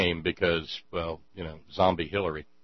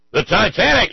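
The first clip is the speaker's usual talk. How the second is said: much higher, loud outraged shout; 11 semitones higher, 10 dB louder than usual. Acted. Goldberg to Mister Wong, high outraged shout. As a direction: blurt it out in outrage. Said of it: "shouts Goldberg".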